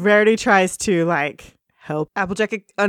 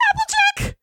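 high pony voice; faster than usual. Acted. Voicing upset Pinkie Pie.

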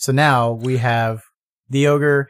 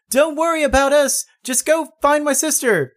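voicing Tila; 12 semitones higher than usual. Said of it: "Tila say".

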